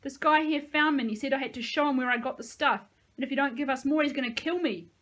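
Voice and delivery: scared pleading shout. A narrator's reading. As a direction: plead for his life. Threatened man shouting through gate.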